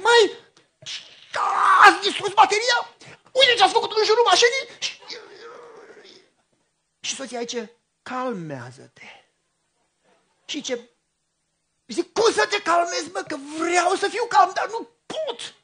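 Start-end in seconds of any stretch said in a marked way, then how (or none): 0.0-4.8 high, loud angry yell; furious shout at kids. Retold or imitated. Ceartă povestită după căzătură. 8.0-9.2 1 calm soothing wife. Retold or imitated. Soția îl liniștește. 12.1-15.5 high, loud, slowing to a strained cry; can't calm down and says so; exasperated outburst. Retold or imitated. Răspuns nervos soției.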